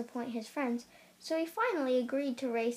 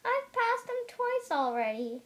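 smug boast; 9 semitones higher than usual. A narrator's reading. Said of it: "said Hare".

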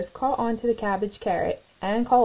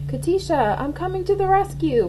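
brave cry; 9 semitones higher than usual. A narrator's reading. Clara flies to save Katisha, higher and bold. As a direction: call out bravely. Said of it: "cried Clara".

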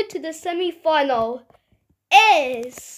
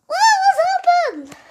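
high, loud astonished toy voice; shocked character voice; 13 semitones higher, 5 dB louder than usual. Pretend. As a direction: squeal it in disbelief. Put-on character voice reacts.

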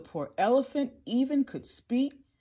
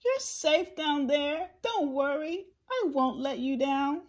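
higher-pitched, earnest reassurance; Horton's raised, comforting voice; reassuring elephant; 7 semitones higher than usual. A narrator's reading. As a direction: call down kindly to someone tiny. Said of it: "Horton called back to the mayor".

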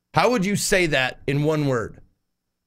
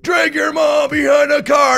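shouting; 8 semitones higher, 5 dB louder than usual. Retold or imitated. Reading a metal-lyric TTS donation.

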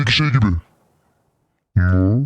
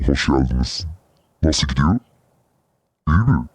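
creepy demon voice; 10 semitones lower than usual. Pretend. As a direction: growl like a horror game monster. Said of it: "Emily Wants to Play'deki ses gibi geliyor". (korku oyunu iblis sesi)

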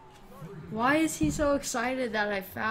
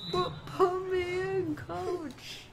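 hammed-up plea; 9 semitones higher, much slower than usual. Playful chant.

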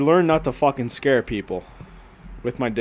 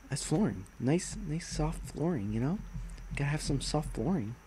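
much softer, intimate; soft aside; 15 dB quieter than usual. Pretend. Streamer showing soft floor tiles, murmuring softly. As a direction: murmur it lovingly.

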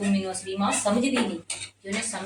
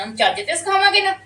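heated retort; 7 semitones higher, faster than usual. Acted. Wife quarrels with husband.

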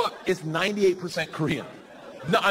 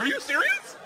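very high-pitched mock astonishment; incredulous. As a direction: shoot up high in disbelief. Acted reaction to the name.

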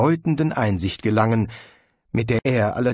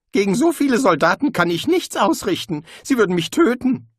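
higher, louder fearful outburst; panicky, higher and louder; frightened protest; 10 semitones higher, 5 dB louder than usual. Acted. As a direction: squeal it out in fear of dying. Rincewind frightened arguing with conscience.

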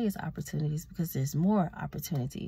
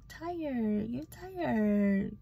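mocking voice; 5 semitones higher, much slower than usual. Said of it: "people who say".

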